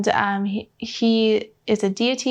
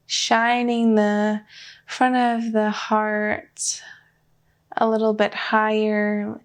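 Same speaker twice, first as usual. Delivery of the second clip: slow, even meditation voice with long pauses; unhurried guidance, leaving room for breath between phrases; guided meditation. Real, not acted. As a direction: lead them gently inward, phrase by measured phrase.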